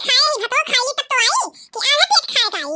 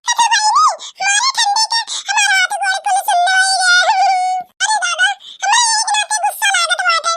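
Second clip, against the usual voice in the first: wailing from cold; 7 semitones higher than usual. Acted. Wife freezing after husband pulls the quilt, high wail. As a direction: cry out as if numb with cold.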